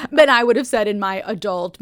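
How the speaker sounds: ordinary delivery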